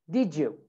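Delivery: "did you" run together as "didjoo"; "did you" with a J in the middle; linked J sound. Shown as a demonstration. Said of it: "it makes a J sound".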